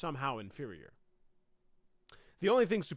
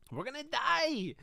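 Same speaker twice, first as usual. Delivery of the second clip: put-on Mexican accent; 11 semitones higher than usual. Fleeing Aztecs screaming to each other, Mexican accent panic. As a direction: shriek the warning in a Mexican accent. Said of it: "doing my best to do a Mexican accent".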